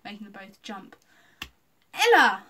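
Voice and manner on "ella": loud, high startled cry; sudden yelp of surprise; gasped. A narrator's reading. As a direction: exclaim the name in shock. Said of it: "Norman gasped".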